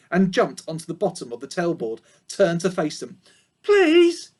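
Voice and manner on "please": high, loud pleading piglet; pleading piglet cry. A narrator's reading. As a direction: beg as the little piglet. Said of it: "he cried".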